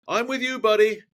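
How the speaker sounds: high excited retold reply; lit up, eager. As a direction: pipe up high, acting out a friend lighting up. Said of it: "Kev just lit up".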